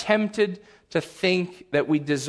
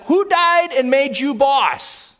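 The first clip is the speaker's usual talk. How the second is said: louder, higher, slow sarcastic jab; retold angry thought at a bossy child, louder; mock angry retort; 8 semitones higher, 5 dB louder, slower than usual. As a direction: spit it out slowly at the child. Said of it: "I wanted to say to them".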